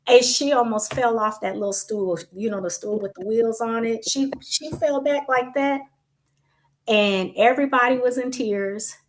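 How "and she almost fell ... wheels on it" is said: ordinary delivery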